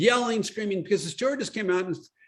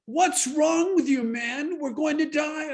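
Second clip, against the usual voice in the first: panicked passenger; 10 semitones higher, slower than usual. Retold or imitated. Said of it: "somebody turned around to me".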